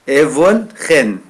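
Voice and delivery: "Evolchen" said slowly, falling; model pronunciation. Shown as a demonstration. Teacher models a word for students to repeat, slowly. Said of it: "dites avec moi".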